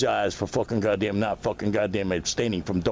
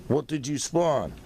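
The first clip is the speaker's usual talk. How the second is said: ordinary talk, nothing marked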